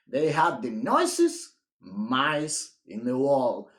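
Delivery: plain reading voice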